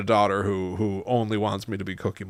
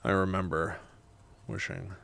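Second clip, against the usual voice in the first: measured, soft reading with long pauses; memoir narration; 10 dB quieter, slower than usual. A narrator's reading. Writer narrating grandma's visit, measured memoir reading. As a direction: read it quietly, phrase by phrase. Reads own memoir aloud in takes.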